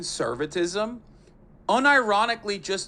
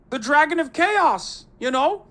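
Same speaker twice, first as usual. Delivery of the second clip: high, loud mock-grandiose line; mock his grand mythic phrase; high hammed-up mythic pronouncement; mock grandiose Peterson; 9 semitones higher, 5 dB louder than usual. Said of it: "mocking Peterson catchphrase".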